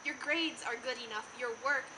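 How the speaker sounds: hushed, gentle and soothing; softly, as if comforting someone, with pauses to let it land; soft reassurance. Acted. Student voicing the lies adults tell teenagers, soft reassurance.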